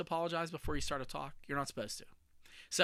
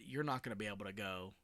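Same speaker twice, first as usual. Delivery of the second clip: retold mom's words; 6 semitones lower, 9 dB quieter than usual. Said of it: "looked at me and said".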